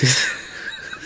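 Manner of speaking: soft, breath-only snicker; chuckle. Real, not acted. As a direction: snicker quietly at a funny memory. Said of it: "I shouldn't be laughing at that".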